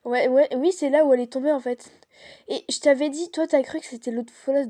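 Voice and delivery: loud, eager telling; excited. Real, not acted. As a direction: blurt it out, all excited. Excited story about the fall.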